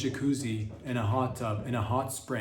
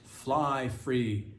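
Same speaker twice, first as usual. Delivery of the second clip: usual speaking voice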